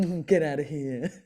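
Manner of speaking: a changed, camp Carry On voice; playful camp brush-off, lightly imitated; Kenneth Williams. Mimicking the voice box laugh line in camp Carry On style. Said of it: "Kenneth Williams laughing, going".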